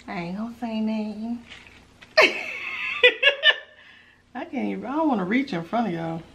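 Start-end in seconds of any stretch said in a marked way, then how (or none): 2.2-3.6 high giggle. Real, not acted. Giggling over the food.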